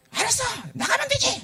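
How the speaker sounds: 확 높아진 목소리로 성질부리며 외치는 철없는 아들; 쾅 하고 문 박차고 나가듯 소리쳐; 성질내며 외치기. Acted. Son storms out of father's house. (아버지에게 대드는 아들처럼, 높고 성난 외침)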